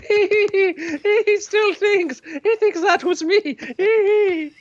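high, tittering snobbish voice; giggling emperor. Acted. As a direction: giggle through every word. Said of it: "a man sitting on the throne going".

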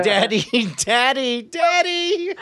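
put-on very high, slow, plaintive child cry; child voice calling Daddy. Probe mocked as small child calling its father. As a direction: whimper Daddy like a lost little kid.

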